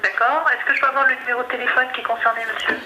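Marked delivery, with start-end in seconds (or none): none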